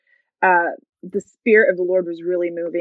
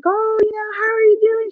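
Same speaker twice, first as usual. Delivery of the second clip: high, bright-eyed church lady hello; Sister Brenda's greeting; 13 semitones higher, 4 dB louder than usual. Retold or imitated. Youth pastor spotting her at church, high excited greeting. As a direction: greet her like a delighted old friend.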